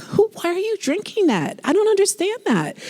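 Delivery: high, incredulous why-would-you; mock disbelief. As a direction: act baffled at the thought. Mock disbelief at Diet Coke.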